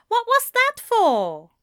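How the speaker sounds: very high, loud outraged protest; indignant exclamation. A narrator's reading. Brother just smacked by his sister, indignant exclamation. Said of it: "Ezra exclaimed, rubbing at the spot".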